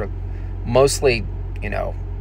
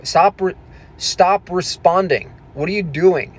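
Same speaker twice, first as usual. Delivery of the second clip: pleading, incredulous telling-off; exasperated scolding; 5 semitones higher than usual. Retold or imitated. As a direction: imitate exasperated viewers telling him off. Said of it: "people are like".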